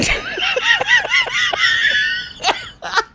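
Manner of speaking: raspy smoker's cackle; loud, rough wheezing laugh. Real, not acted. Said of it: "the worst smoker's laugh imaginable".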